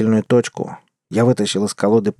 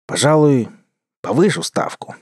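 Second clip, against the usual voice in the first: feigned doubt; 3 semitones higher than usual. Acted. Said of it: "Придал своему голосу сомнение".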